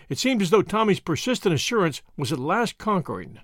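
ordinary delivery

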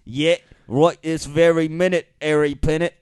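broad Cockney gang leader; hammed-up London Cockney. Shown as a demonstration. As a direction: urge your mate on in Cockney. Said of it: "London Cockney".

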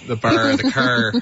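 "bar" and "car" with a broad Maritime "ar"; East Coast Canadian pronunciation. Shown as a demonstration. Showing co-hosts the sound, East Coast "bar" and "car". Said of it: "what you get from the East Coast".

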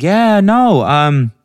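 high, loud mimicked friend; high-pitched, insistent friend voice; Bridget on the phone. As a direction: imitate an oblivious friend on a call. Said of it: "she was like".

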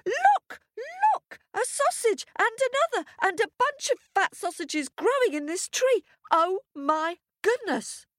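very high, excited squeal; astonished wife. Acted. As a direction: squeal in mounting amazement. Wife spots sausages, tugs sleeve.

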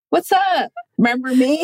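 high-pitched, singsong surprise hello; playful greeting. Retold or imitated. Woman reenacting turning up at her ex's hometown, playful high greeting. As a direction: pop up with a high, chirpy hello. Acts out greeting long-lost ex.

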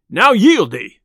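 loud, a gruff demand to give up; commanding shout. A narrator's reading. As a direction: bark the order at him. Tinker demands surrender in fight.

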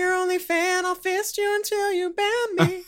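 high falsetto creepy superfan; creepy fan voice. Pretend. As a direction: squeak it like a leering fan. Joke fan persona, falsetto.

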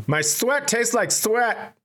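put-on high, slow lisping little boy; slow kiddie voice with a lisp; imitated young nephew. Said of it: "he licked his arm and goes".

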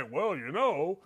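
mock a resigned Southern Indiana local; Southern Indiana accent. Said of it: "they all talk like this".

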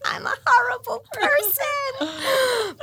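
very high, slow, fake sob; high slow mock cry of a guilty mum; mock crying wail. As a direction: wail it in hammed-up despair. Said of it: "And I'm like".

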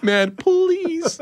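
high, drawn-out pleading; mock begging. Customer begs the tire guy for his slot, high hammed-up pleading. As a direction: beg pitifully, hammed-up for laughs. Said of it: "I start begging this guy".

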